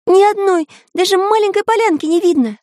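very high, insistent exclamation; high-pitched, urgent lookout; exclaiming. A narrator's reading. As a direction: cry out the report in a high voice. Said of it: "воскликнул Карандаш".

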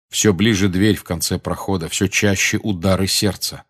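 usual speaking voice

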